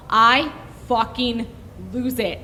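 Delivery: ordinary talk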